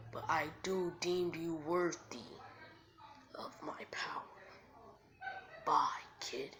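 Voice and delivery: slow, weighty, partly whispered pronouncement; measured, breath-heavy creature voice; solemn great fox. Acted. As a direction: grant power like an ancient beast.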